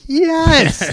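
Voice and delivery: over-the-top eager yes; excited. Retold or imitated. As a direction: squeal it like a thrilled fan. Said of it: "and I'm like".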